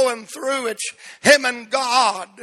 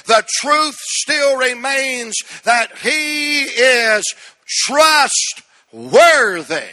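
slow, louder, stretched-out trustworthy; preaching shout; 3 semitones higher, 4 dB louder, much slower than usual. Real, not acted. Preacher to congregation, rising to a slow shout. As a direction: drive it home at full force. Sermon climax.

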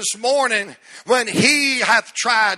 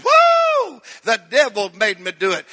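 TV preacher whoop; 3 dB louder than usual. Retold or imitated. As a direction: mimic the comic's signature cry. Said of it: "and that guy said".

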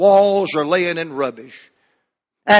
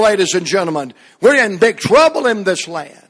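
normal talking voice

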